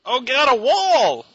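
high, hammed-up alarm; comic cry of horror; mock panic. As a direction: yelp in fake terror. Dubbing a panicked film character.

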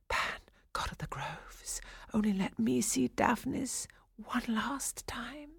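breathy whispered plea; whispering. A narrator's reading. Captive girl praying to Pan as she dances, whispering. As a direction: whisper it like a desperate prayer. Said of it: "all the while whispering".

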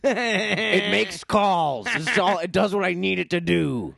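high and very slow, a put-on codger; mock old-timer voice. Defending his old phone, hammed-up old-timer voice. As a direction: play a stubborn old man content with his phone.

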